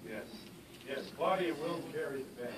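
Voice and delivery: usual speaking voice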